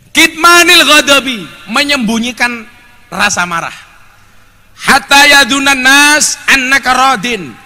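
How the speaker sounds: high, loud, measured sermon delivery; forceful preaching. Real, not acted. Preacher teaching the congregation about hiding anger, forceful preaching.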